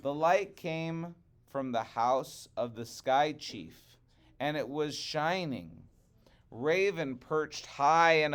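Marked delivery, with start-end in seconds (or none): none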